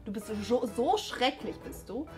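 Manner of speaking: ordinary delivery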